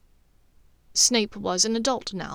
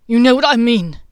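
loud, anguished outburst; anguished cry; 2 semitones higher, 12 dB louder than usual. A narrator's reading. Snape crying out to Dumbledore in anguish, loud. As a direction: let the anguish break through. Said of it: "Snape's anguished cry was evident".

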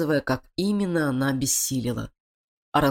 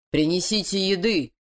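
curt order; 4 semitones higher than usual. A narrator's reading. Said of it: "резко бросил машина орангутангам".

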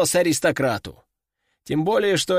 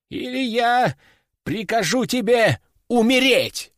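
raised pitch, menacing order; threat; 6 semitones higher than usual. Acted. Master threatening the demon, higher and menacing.

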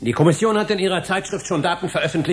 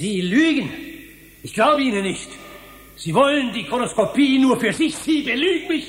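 higher, heated accusation; husband accuses the young scientist of lying, heated and higher; angry accusation; 7 semitones higher than usual. Acted. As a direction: hurl the accusation straight at him. Heated quarrel, accusing of lies.

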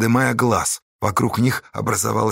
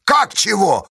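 higher, noisy retort; full-voiced uproar; 8 semitones higher, 4 dB louder, slower than usual. A narrator's reading. Pozdey retorting to the raftsman, full-voiced shout. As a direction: make a row at full voice. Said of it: "в полный голос шумел Поздей".

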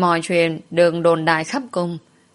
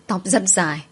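usual speaking voice

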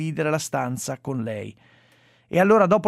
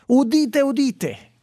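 town crier; 8 semitones higher, 4 dB louder, slower than usual. Pretend. Announcing a news item to listeners.

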